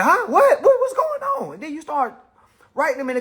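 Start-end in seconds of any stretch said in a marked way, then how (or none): 0.0-1.5 much higher, startled questions; mock confusion. Replying to someone talking about you, mock surprise. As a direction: play dumb at the insult.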